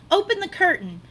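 usual speaking voice

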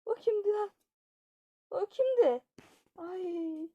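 who was that, jumping in fright; startled fright. Acted. Startled by the prank. (ürkmüş bağırış)

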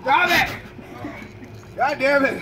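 pained yelling. Real, not acted. Reacting to the burning pain.

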